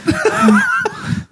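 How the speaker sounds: rising squeal of laughter; high laugh. Real, not acted. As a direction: crack up. Laughing at the joke.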